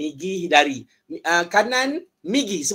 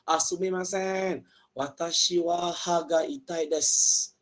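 slow careful patient model; 3 dB quieter, slower than usual. Shown as a demonstration. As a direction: say it slowly to the doctor. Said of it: "Pergi jumpa doktor".

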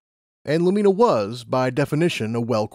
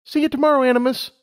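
put-on high, eager young mare; Lumina's voice; 14 semitones higher, 4 dB louder, faster than usual. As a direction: voice her up high, keen and bright-eyed. Lumina leaves.

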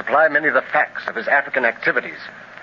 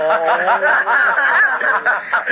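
hearty high laugh; 11 semitones higher than usual. Acted. Clint laughs at Barney finding out it's monkey meat, hearty laugh. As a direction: burst out laughing at a friend's dismay.

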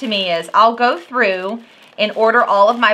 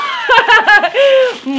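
loud laugh; 13 semitones higher, 6 dB louder than usual. Real, not acted. Laughing at packing.

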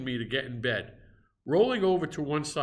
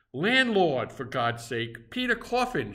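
panicked shout; 3 semitones higher, 3 dB louder than usual. Acted. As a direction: yell for the landlord in terror. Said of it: "shouted I".

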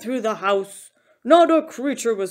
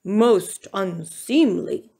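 slow, prim bear disapproval; disapproving bear; 3 dB louder, slower than usual. A narrator's reading. Bear turns down Mouse's presents, slow disapproval. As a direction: draw it out, stuffily disapproving. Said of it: "Bear shook his head".

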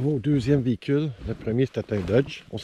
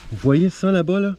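calling out; 8 semitones higher, 6 dB louder than usual. Real, not acted. Calling out to others.

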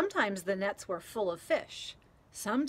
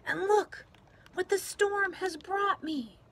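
put-on high, bright little-girl voice, louder; delighted child; 11 semitones higher, 5 dB louder than usual. A narrator's reading. Stina showing grandpa her storm present, delighted. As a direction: show off the treasure proudly. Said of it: "said Stina".

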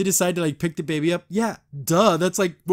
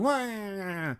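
put-on drawn-out "wah" like a little kid; mock kid wail; 3 semitones higher, 6 dB quieter than usual. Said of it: "sounds like a little kid going".

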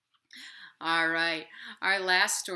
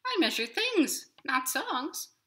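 much higher squeaky little worm voice; tiny inchworm; 10 semitones higher, 5 dB louder than usual. Acted. Inchworm begging the hungry robin not to eat him, high and squeaky. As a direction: plead as a tiny bug. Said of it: "said the inchworm".

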